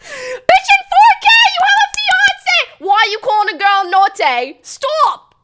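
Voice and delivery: very high, loud shrieking; outraged screaming. Real, not acted. Screaming at the fiance's flirting.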